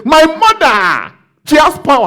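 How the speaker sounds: high, slow, loud mock boast; mocking the boastful guy. Coworker boasting to the pastor, high slow mock voice. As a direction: ham up the man bragging about his mother. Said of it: "this guy was supposed to say".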